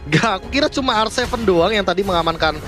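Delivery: ordinary delivery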